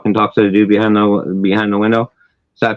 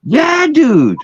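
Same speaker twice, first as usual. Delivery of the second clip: high-pitched, emphatic swearing it's true; sincere insistence; slower than usual. Real, not acted. Storyteller to doubting listener, earnest insistence. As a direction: insist hard that you're not lying. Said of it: "You're sincere as fuck about your story".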